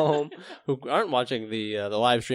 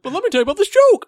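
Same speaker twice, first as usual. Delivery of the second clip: squeaky cartoon employee voice; goofy high voice; 7 dB louder, faster than usual. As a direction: play the nervous worker, high and loud. Playful skit with a boss.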